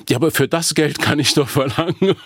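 high-pitched mock complaint; mock client protest. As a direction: protest as the put-out paying customer. Acted client protesting in a quarrel.

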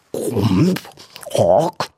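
animal rumble, then a snort; rhinoceros grunt. A narrator's reading. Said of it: "machte das Nashorn". (Grunzen eines Nashorns)

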